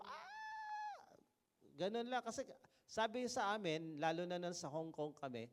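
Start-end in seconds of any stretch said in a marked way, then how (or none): 0.0-1.1 high falling scream like dropping on a ride; rollercoaster scream. Retold or imitated. Preacher imitating a rider dropping down a ride, high scream. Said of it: "Kaya ang sigaw mo".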